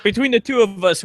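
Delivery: ordinary delivery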